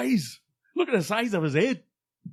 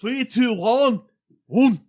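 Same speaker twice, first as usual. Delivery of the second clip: loud, higher countdown in a put-on Brett voice; a loud, pitched-up mock Brett countdown; 7 semitones higher, 7 dB louder, slower than usual. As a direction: count down like Brett, punching each number. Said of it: "in your Brett voice".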